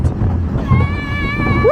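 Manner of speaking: very high, long whoop; thrilled whoop. Real, not acted. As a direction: whoop with delight. Coaster speeding.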